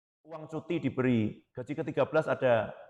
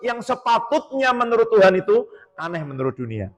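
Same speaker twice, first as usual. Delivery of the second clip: loud, soaring and higher preacher's climax; fervent preaching; 8 semitones higher, 7 dB louder than usual. Acted. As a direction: lift the congregation toward heaven. Preaching at full force. (khotbah berapi-api, keras dan tinggi)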